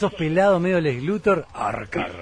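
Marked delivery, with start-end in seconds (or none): 1.5-2.1 deep, low mock villain voice. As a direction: intone the name like Lex Luthor. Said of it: "tenés una pinta de villano". (voz grave de villano de historieta)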